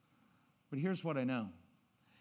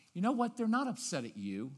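normal talking voice

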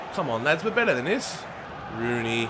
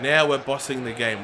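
ordinary delivery

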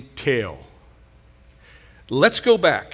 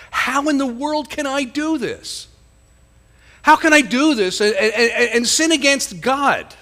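anguished self-question; 7 semitones higher than usual. Acted. Joseph talks to himself.

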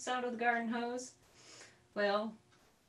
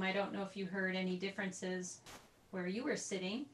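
ordinary talk, nothing marked

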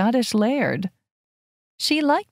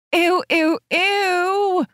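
disgusted squeal; 10 semitones higher, much slower than usual. A narrator's reading. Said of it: "Astrid interrupted, shaking her head".

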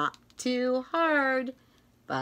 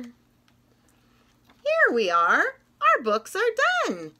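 much higher bright announcement; excited; 7 semitones higher, 4 dB louder, slower than usual. Acted. Reader telling kids the books are finished, excited. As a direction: cheer that the books are done.

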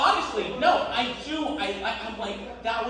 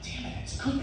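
1 hushed mock frustration; 6 semitones higher, 7 dB quieter than usual. Inner thought over dinner.

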